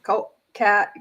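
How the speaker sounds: normal talking voice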